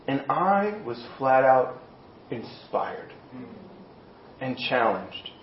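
moved. Real, not acted. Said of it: "I feel like I am, if you can't tell".

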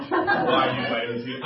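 ordinary delivery

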